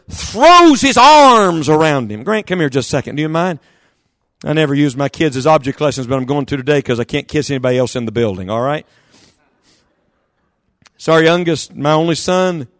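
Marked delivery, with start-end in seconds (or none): none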